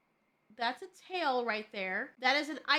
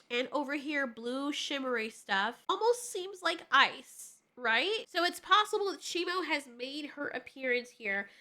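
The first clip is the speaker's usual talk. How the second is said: ordinary talk, nothing marked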